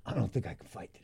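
soft, reluctant mumble; feeble refusal. Retold or imitated. Messed-up fighter to host, begging off softly. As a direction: back out weakly, hushed.

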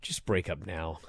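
low, soft, dejected delivery; mock-downcast, dropped voice; Andre Chase quote. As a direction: say it quietly and low, imitating him. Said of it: "Andre Chase goes".

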